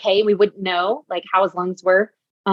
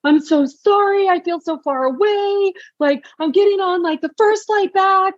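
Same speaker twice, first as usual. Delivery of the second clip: imitated crying husband; 12 semitones higher than usual. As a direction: play the husband crying on the phone, pitched up. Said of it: "he just starts bawling on the phone".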